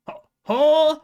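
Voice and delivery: loud, hammed-up Australian accent that slips toward British; a cheerful, defiant mock Australian accent. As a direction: play the grimy Pokemon in a jokey Australian accent. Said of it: "I'm gonna do Australian".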